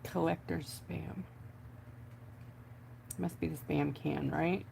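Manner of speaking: quiet, slow disbelieving repeat; slow, soft musing. Real, not acted. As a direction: say Collector Spam slowly and softly to yourself. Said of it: "What in the world does that even mean?".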